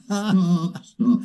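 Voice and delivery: high laughing plea to stop; amused, breathless stop. Real, not acted. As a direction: crack up and beg him to stop.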